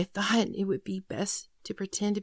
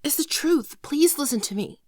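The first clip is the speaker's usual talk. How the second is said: urgent pleading; 6 semitones higher, 5 dB louder than usual. Acted. Begging boyfriend to believe her.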